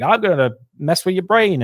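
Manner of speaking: mock psyker taunt. Psyker taunting in retold story.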